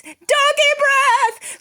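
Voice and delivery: high, loud, slow panicked scream. Acted. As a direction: shriek it as fleeing soldiers. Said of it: "screamed the soldiers".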